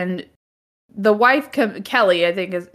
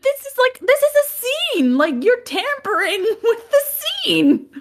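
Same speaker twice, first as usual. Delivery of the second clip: much higher, worked-up protest; excited outrage; 13 semitones higher than usual. Real, not acted. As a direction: squeal the point that it's a scene. Outraged about tampering with scene.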